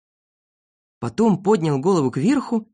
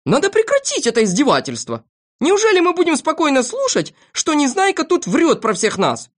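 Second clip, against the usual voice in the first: high, outraged appeal; Пилюлькин, shouting; 11 semitones higher, 3 dB louder than usual. A narrator's reading. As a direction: demand indignantly that it stop. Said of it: "закричал доктор Пилюлькин". (возмущённо, высоким голосом)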